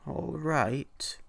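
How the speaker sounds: man hesitantly accepting the hag's offer; hesitant old man. A narrator's reading. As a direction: say it with reluctance. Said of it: "he said hesitantly".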